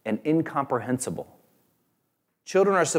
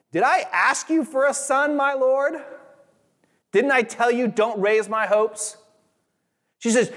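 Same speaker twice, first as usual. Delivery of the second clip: anguished mother; 14 semitones higher than usual. Acted. Grieving mother confronts the prophet.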